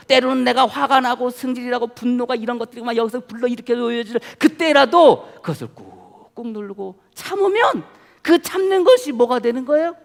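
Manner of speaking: ordinary talk, nothing marked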